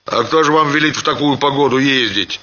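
суровый старик, быстро и выше; строго отчитывает барина, скороговоркой; сурово. A narrator's reading. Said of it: "проговорил Семён сурово". (старый Семён сурово отчитывает Ханова, быстро)

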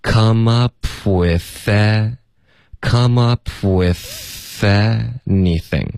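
very slow "with anything", th carried into the a; linked reading. Shown as a demonstration. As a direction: stretch "come up with anything" into joined syllables. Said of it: "也得连读". (极慢速连读“with anything”)

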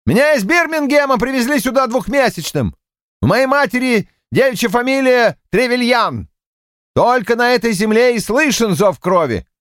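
high, passionate patriotic shout. A narrator's reading. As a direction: yell it, chest-thumping pride. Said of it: "вновь сорвался на крик".

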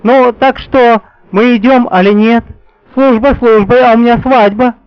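high, loud impatient bride; impatient young woman. A narrator's reading. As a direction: hurry them along, eager. Alyona stamps, impatient. (нетерпеливая невеста)

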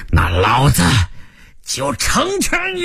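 furious villain's yell, rising sharply at the end; enraged roar. Acted. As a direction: bellow a killing threat, stretching the last words. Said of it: "峰哥大吼一声".

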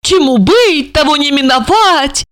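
high, exaggerated mocking echo; mocking mimicry. A narrator's reading. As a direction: parrot his gloomy words back in a high taunt. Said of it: "передразнила его Ольга".

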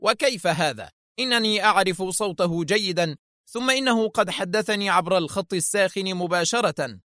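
high-pitched doubting rebuttal; sharp. A narrator's reading. Director insisting he knows the voice, sharp. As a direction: object sharply, raising the pitch. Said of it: "قبل أن يقول في حدة".

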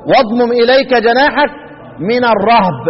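الرهب recited as ar-rahb; verse ending in one-syllable "rahb" for الرهب; third of three readings. Shown as a demonstration. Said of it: "ثلاث قراءات".

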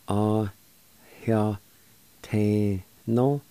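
"Ah, Heo te no." in separate held syllables. Shown as a demonstration. Teacher showing learners the first part "Ah, Heo te no.", syllable by syllable. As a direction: pause between the syllables of "Ah, Heo te no.". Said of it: "First part is pronounced like this".

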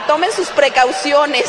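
loud, slow, playful warning shout; joking shout. Real, not acted. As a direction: boom a mock warning at the crowd. (advertencia en broma, gritada y lenta)

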